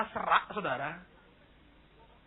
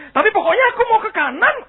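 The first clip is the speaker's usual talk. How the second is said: high, loud, fast outburst; stubborn acted insistence; 12 semitones higher, 11 dB louder, faster than usual. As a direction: insist over all objections, loudly. Iblis ngotot membantah. (ngotot dengan suara tinggi dan cepat)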